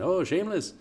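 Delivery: imitating an old friend's Spanish accent, lightly. Mimicking his buddy's dad calling him Shameless in a Spanish accent. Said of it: "He talks with this, like, Spanish accent".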